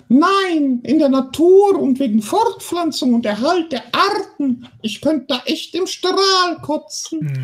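high, slow, bleating whine; bleating nagging voice. Acted. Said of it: "verstellt die Stimme meckernd".

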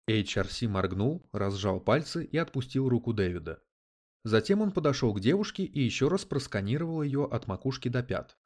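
ordinary delivery

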